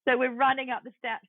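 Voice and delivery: normal talking voice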